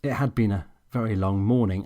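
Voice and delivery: plain reading voice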